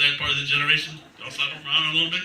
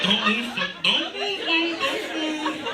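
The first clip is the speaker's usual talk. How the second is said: higher, slow pleading at the shaky stage; mock panic; 11 semitones higher, much slower than usual. On a shaky stage, begging it not to move in higher mock panic. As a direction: beg the stage to hold still. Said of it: "I'm like".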